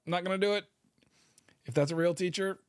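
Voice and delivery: ordinary talk, nothing marked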